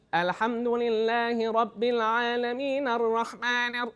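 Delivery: drawn-out nasal Arabic recitation, not pushed down. Shown as a demonstration. Teacher showing students the faulty way, nasal slow chant. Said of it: "hear the nasalization going crazy".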